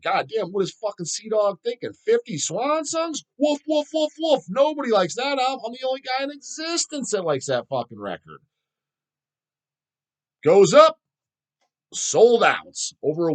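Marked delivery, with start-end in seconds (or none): 0.0-8.4 much higher, incredulous scoffing voice; mock inner rant. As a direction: sneer at the order in your own head. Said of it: "'cause I'm thinking".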